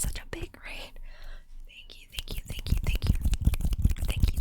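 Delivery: normal talking voice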